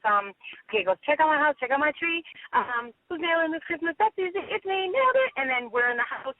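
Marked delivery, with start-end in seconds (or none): 1.0-5.3 pitched-up ad lines, climbing higher as they go; reenacted commercial. Actress replaying her Big Lots commercial for the host, commercial voice. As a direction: replay your commercial part in a raised voice. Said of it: "the part in the commercial where it goes".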